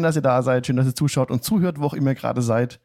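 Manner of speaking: normal talking voice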